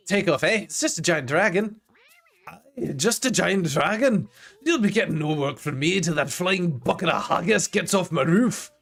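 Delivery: put-on a gruff dwarf grumbling in a mild Scottish accent. Reading a Scottish dwarf NPC's lines.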